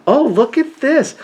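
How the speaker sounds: high, slow, dreamy shopper's coo; mock wife voice. Teasing husband imitates his wife shopping, high coo. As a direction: play the wife cooing over every item. Mimicking wife browsing at Walmart.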